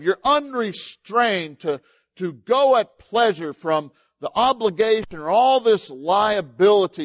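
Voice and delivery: loud, high, emphatic pulpit delivery; forceful preaching. Real, not acted. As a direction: drive each phrase home to the congregation, slowly. Preaches and reads scripture forcefully.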